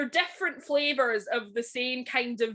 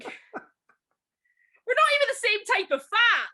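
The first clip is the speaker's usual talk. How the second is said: laughing; 11 semitones higher, 4 dB louder, slower than usual. Real, not acted. Laughing exasperated rant.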